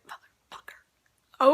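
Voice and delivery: slow stunned whisper; a slow whispered curse of disbelief. Retold or imitated. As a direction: breathe it out in disbelief. Stunned reaction to hug demand.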